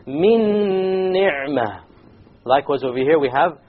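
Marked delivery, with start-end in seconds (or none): 0.1-1.9 Min ni'mah chanted high and very slow, noon merged into meme with nasal gunna; recited with gunna. Shown as a demonstration. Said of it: "you add a gunna".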